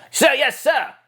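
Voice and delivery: high, crisp soldier reply; generic clone. Shown as a demonstration. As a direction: snap off a quick sir yes sir. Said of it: "the generic clone".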